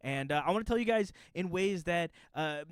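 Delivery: ordinary talk, nothing marked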